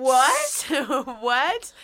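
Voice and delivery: high, loud disbelieving what; stunned what. Retold or imitated. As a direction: repeat it in shocked disbelief. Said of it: "Where you're just like".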